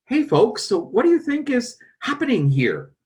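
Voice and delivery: high, breezy Paul addressing the crowd; Paul putting a question to the folks, pitched high and chatty; Paul asking folks. Retold or imitated. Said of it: "In other words".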